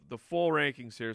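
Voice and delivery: normal talking voice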